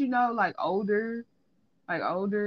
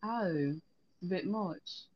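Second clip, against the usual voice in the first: softly clipped-off British aside; British accent; 2 semitones lower, 8 dB quieter, much slower than usual. Shown as a demonstration. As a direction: add a quiet touch of British accent. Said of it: "I have a good British accent".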